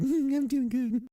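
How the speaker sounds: squeaky mock reply. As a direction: answer like a shy kid, way up high. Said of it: "And it's like".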